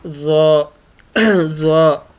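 ज़्वा thick and heavy, tongue tip on the upper gum; heavy letter demo. Shown as a demonstration. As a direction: repeat ज़्वा heavily for the class. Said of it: "इसको भी मोटा पढ़ते हैं".

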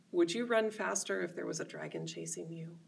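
usual speaking voice

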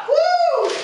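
shrill triumphant whoop; high whoop. Acted. Contestant whoops after being picked, very high. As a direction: let out a high victory whoop.

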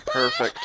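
very high cracked wailing perfect; sarcastic wail. Real, not acted. Gamer reacting to bad news, cracked high wail.

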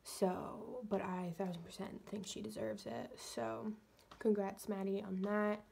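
soft whispered morning chat; whispering. Real, not acted. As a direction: whisper it, barely voiced. Quiet morning-after recap.

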